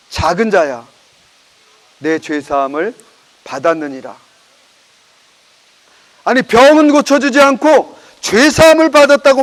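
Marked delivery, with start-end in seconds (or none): 0.1-4.2 lower, softer tender pronouncement; Jesus gently forgiving the paralytic, lower and softer; gentle Jesus. Acted. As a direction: speak it softly like a blessing.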